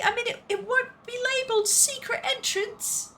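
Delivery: high-pitched, chatty character voice; high cartoonish storybook voice; game hero's companion. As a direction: pitch it high and explain the plan.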